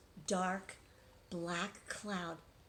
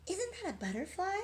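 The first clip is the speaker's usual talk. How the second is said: surprised mother; 10 semitones higher than usual. A narrator's reading. Mother voiced in story.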